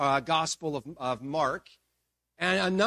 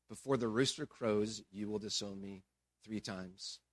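low, soft, heavy remembered words; somber recollection; 8 semitones lower, 8 dB quieter than usual. Acted. Peter remembers the word Jesus had spoken, somber. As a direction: let it sink quietly, weighted with regret.

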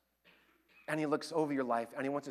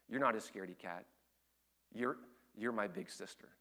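softer, lower, tender reassurance; gentle little sister; 4 semitones lower, 8 dB quieter than usual. Retold or imitated. Six-year-old reassures her timid older sister, softer and lower. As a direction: comfort her big sister softly. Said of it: "she looked at her and said".

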